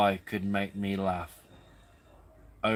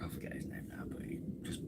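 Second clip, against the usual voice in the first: whispered aside; 15 dB quieter, faster than usual. Real, not acted. Trailing off, searching for name.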